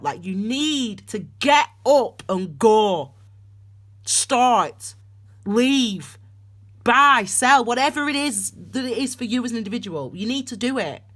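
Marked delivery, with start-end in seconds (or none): 0.0-7.2 slow, weighted imperatives; emphatic, spaced-out urging; strong command. Real, not acted. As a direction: punch each command separately. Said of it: "to how that message came out".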